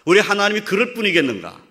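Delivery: impassioned Korean preacher; full-force preaching. Real, not acted. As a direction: preach it with rising fervour.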